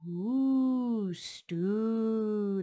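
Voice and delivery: drawn-out ooh; craving. Acted. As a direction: croon it hungrily. Vendor craving.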